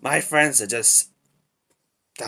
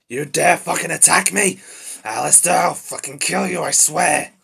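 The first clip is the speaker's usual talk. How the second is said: loud, raised-pitch outrage; heated, shouted warning; furious threat; 6 semitones higher, 6 dB louder than usual. Acted. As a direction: threaten your attacker at full indignation. Threatens after being attacked.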